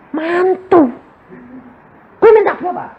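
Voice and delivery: high startled retort to his wife; surprised. Retold or imitated. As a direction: squeak out the surprise. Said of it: "lalu dia bilang". (kaget bertanya ke istri dengan suara tinggi)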